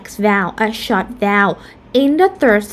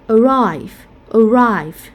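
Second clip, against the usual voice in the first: arrive stressed on RIVE, falling; stress on second syllable; slower than usual. Shown as a demonstration. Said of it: "second syllable will have a primary stress".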